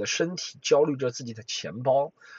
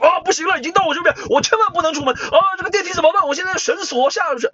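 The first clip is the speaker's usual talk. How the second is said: much higher, louder comic panic; mock panic shout; 5 dB louder than usual. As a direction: shout it like total terror. (高声大喊、夸张惊恐)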